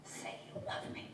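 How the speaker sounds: whispered, pleading demand; barely voiced, as a father begging through tears; mild acted sob. A narrator's reading. Drunk father pressing his child for love, whispered half-sob. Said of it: "he began to sob".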